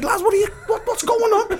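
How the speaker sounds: high-pitched, acted disbelief; bewildered protest. Man caught up in a brawl asking the lads, high and bewildered. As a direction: stumble into a baffled, high question.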